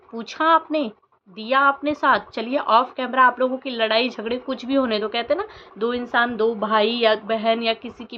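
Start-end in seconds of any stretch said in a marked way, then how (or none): none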